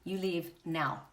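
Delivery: low, slow and deliberate order. Acted. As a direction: issue a quiet, measured ultimatum. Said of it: "my higher self says".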